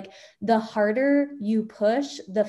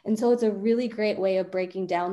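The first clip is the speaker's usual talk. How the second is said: usual speaking voice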